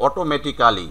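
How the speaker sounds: "অটোমেটিক্যালি" with a full "kaa" before "li"; every syllable of "অটোমেটিক্যালি" sounded; local "অটোমেটিক্যালি". Shown as a demonstration. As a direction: say "অটোমেটিক্যালি" as oto-meti-KAA-li. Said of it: "এভাবেই উচ্চারণ করে থাকি".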